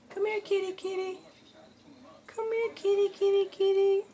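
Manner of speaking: high, even sing-song calling; kitty call. Pretend. Owner teasing a dog as a kitty, high calling. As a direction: call a cat in a high, level voice.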